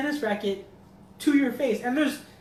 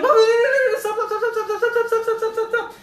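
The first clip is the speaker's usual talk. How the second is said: high, loud, rapid-fire begging; frantic mock plea, words tumbling out; panicked pleading; 13 semitones higher, 7 dB louder than usual. Son stops mom attacking kids, panicked pleading.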